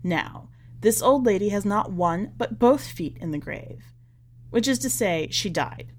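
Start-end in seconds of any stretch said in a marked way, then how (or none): none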